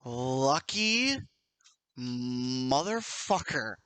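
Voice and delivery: very slow, drawn-out words; amazed disbelief. Real, not acted. Game master to player after lucky roll, slow amazed disbelief. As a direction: stretch each word, with long gaps.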